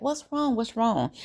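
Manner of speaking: high, soft questioning; imitated concern. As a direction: mimic worried girlfriends, gently and high. Voices girls seeing friend is sad.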